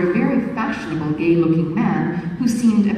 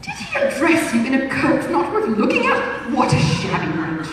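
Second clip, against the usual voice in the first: high, hammed-up outrage with a breathy fie; theatrical fop feigning shock; lightly voiced dandy pretending offense; mock indignant gallant; 6 semitones higher than usual. Suitor's voice.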